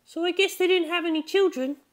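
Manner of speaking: put-on high curious girl voice; Chiho, high girl voice. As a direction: pitch way up like a curious schoolgirl. Narrator voices Chiho's question.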